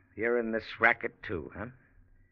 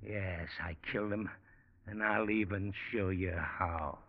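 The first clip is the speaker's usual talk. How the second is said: much softer, slow cold threat; menacing quiet; 4 semitones lower, 12 dB quieter than usual. Acted. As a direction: confess it softly, coldly. Menacing confession before shooting.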